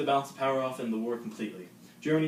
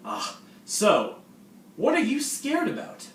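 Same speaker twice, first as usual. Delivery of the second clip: cheery senior; 6 dB louder than usual. Acted. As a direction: greet the freshman like an overeager host. Skit senior character continues.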